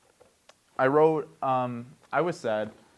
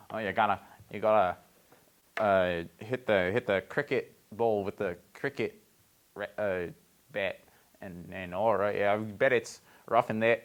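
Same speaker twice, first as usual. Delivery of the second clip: put-on low, amateurish New Zealand accent; 4 semitones lower than usual. Host plays a cricket player, New Zealand accent. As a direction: keep up a halting, bad New Zealand accent. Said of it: "That's my New Zealand accent".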